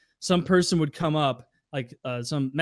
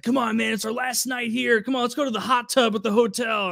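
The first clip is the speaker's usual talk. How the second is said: higher pleading bro voice; imitating begging Andy; 8 semitones higher than usual. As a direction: wheedle him to the hot tub.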